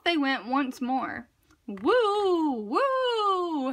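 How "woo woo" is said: higher hooting woo; howling wind. A narrator's reading. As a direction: howl like the wind. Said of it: "howled the wind".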